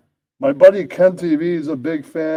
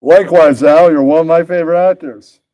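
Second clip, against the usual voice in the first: loud; 8 dB louder than usual. Acted. Loud in-character reply.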